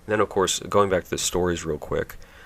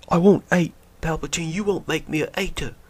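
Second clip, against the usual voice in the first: much higher, petulant vow; mock Rey; 11 semitones higher, 4 dB louder than usual. Mocking Rey's vow about Palpatine, high and sulky. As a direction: pout it like a sulky heroine. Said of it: "quoting Rey".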